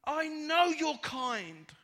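high, loud acted shout; boy's shouted refusal. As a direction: refuse the snake at full voice. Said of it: "And the boy goes".

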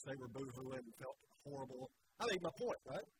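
ordinary delivery

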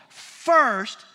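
"first" drawn out and punched within the verse; stress on first. Real, not acted. Preacher reading the verse to the congregation, "first" heavily stressed. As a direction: lean hard and slowly on "first". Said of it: "I am emphasizing the word first".

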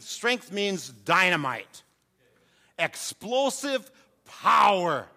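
emphatic preaching. Acted.

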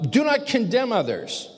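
preacher voicing Jesus' command; stern command. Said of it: "There's another command".

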